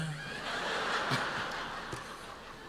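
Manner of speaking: soft airy chuckle; quiet breathy laugh at the story. Real, not acted. Laughs at his own story.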